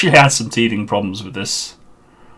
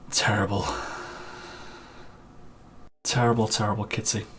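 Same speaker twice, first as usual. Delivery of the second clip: breathy half-voiced whisper, much softer; mock whisper; 2 semitones lower, 15 dB quieter than usual. Streamer mock-scolding Kitsy for naming the badger, in a whisper. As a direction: hiss it under your breath in mock disgust.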